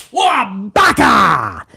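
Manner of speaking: slow drawn-out shouted whack noise; mock punch sound; punch sound effect. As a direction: sell the hit with a cartoon impact.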